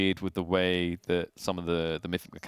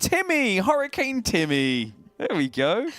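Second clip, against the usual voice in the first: high-pitched, drawn-out mock announcement; gleeful name-picker; 11 semitones higher, 3 dB louder, slower than usual. Hurricane namer picks a name from a baby book, gleeful. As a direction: proclaim the silly name with delight.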